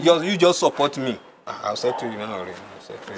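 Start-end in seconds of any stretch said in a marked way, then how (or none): 1.5-3.2 low, soft aside; hushed promise. Acted. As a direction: slip him the promise quietly. Promising a bribe to gateman.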